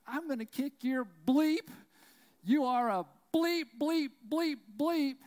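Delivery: screamed threats, high and loud; an angry dad threatening a coach across the stands, screaming; retold furious yelling. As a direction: bellow the threats at him. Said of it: "then he stands up and points".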